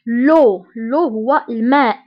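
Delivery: ordinary talk, nothing marked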